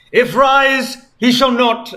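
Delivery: high, loud archaic crowing voice; theatrical mock-archaic crow; crowing Sonic. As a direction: crow it like a gloating villain. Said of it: "Sonic crowed".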